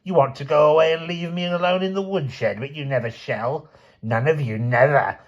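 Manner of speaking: put-on high, quavering old matriarch, wheedling and possessive; a cold old woman clinging to her family; hammed-up old grandmother lament; old grandmother's lament. Aunt Ada's self-pitying tirade.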